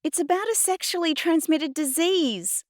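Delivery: high-pitched indignant screech. A narrator's reading. Girl protesting to Adnan about a song, screeching. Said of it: "I screeched".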